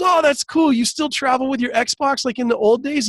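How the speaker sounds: high-pitched, friendly curiosity; impressed retold reaction. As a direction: imitate an old pal, eagerly asking. Retells comedian's friendly Xbox question.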